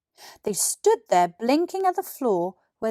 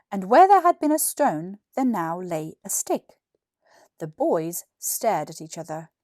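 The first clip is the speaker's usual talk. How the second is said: usual speaking voice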